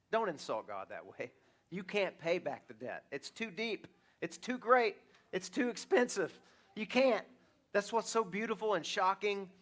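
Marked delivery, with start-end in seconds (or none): none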